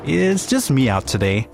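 ordinary delivery

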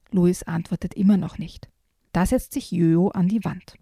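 ordinary delivery, nothing marked

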